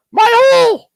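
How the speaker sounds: high hammed-up yell of the quote; mock yell. Friend's drunken cry from last night, hammed-up high yell.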